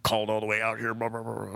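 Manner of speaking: quick mock complaint tailing off into blahs; retold upset grumbling. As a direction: rattle it off as an upset driver's rant. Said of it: "he's, like, real upset for some reason".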